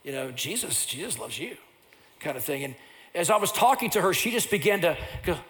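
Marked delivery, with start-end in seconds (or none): 0.0-1.6 tender reassurance. Retold or imitated. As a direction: speak softly to reassure the worried lady. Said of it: "And I said".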